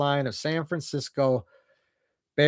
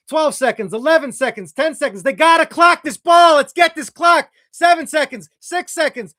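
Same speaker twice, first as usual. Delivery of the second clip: much higher and louder, racing the clock; frantic play call; 8 semitones higher, 7 dB louder than usual. Real, not acted. Fan calling the Bears' last-seconds drive, frantic excited call. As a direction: call it like every second counts.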